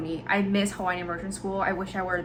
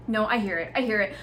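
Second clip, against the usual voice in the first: ordinary delivery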